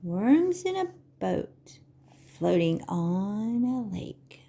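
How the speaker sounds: slow, sing-song picture-book reading; Vorlesen. Acted. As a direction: read to little children, stretching the words with big pauses. Reads picture book to children.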